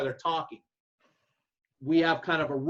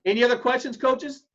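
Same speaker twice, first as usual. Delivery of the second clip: loud, higher call to the room; 7 semitones higher, 5 dB louder than usual. Real, not acted. Speaker calling to the room.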